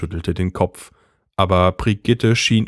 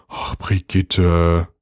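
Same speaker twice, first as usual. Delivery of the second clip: weary husband; 2 semitones lower, much slower than usual. A narrator's reading. Carsten weary sigh.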